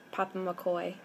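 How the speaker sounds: normal talking voice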